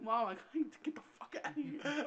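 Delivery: high-pitched mock crying; retold crying plea. Son phoning mom for rescue, mock tearful plea. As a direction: imitate a kid begging mom through tears. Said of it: "I was crying again".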